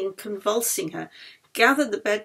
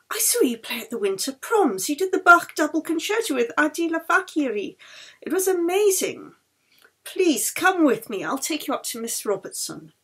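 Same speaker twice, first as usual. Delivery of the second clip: high, eager fan gushing; a nurse thrilled to meet her idol, a bit louder; starstruck nurse; 6 semitones higher, 4 dB louder than usual. Acted. Nurse meeting the famous violinist, eager and higher.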